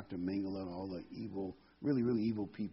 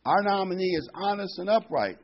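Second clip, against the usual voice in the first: high, loud award presenter; master of ceremonies; 8 semitones higher, 7 dB louder than usual. Acted. MC presenting lawyer award, proclaiming. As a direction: proclaim the nominee to the whole hall. MC announcing at joke banquet.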